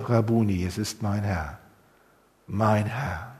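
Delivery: hushed low awe; awed soft recognition. Acted. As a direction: breathe out the recognition quietly. Maria recognizes Jesus, awed.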